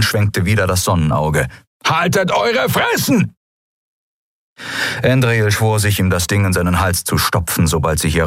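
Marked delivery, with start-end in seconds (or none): 1.8-3.3 high, barking pirate shout; shrill drugged-up pirate shout; angry pirate yell. A narrator's reading. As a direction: snap at the prisoners. Halbhorn yelling.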